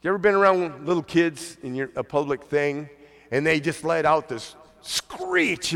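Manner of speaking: usual speaking voice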